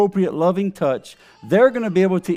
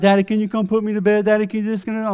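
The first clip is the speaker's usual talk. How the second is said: put-on higher, hurried child's begging; pleading daughter; 6 semitones higher, much faster than usual. Daughter asking dad at bedtime, imitated high pleading. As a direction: imitate a kid pestering at bedtime.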